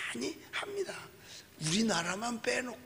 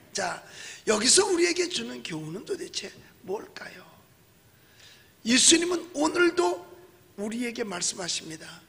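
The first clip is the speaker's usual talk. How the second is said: ordinary delivery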